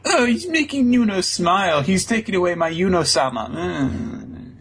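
high squeaky jealous fairy voice. Retold or imitated. Podcaster voicing the jealous fairy Sylph, high and whiny. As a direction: pitch it way up, pouty and jealous. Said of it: "Sylph is jealous because he's like".

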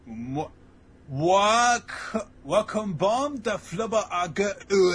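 high, loud, slow slurred wailing; hammed-up incoherent crying; mock blubbered gibberish. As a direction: wail nonsense syllables as if sobbing. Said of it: "on the slurring whenever people cry".

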